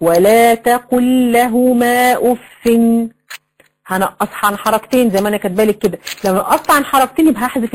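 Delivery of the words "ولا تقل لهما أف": slow, level recitation with held vowels; long, even madd through لهما; recited example. Shown as a demonstration. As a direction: recite ولا تقل لهما أف very slowly. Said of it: "ولما أجي أقول لك".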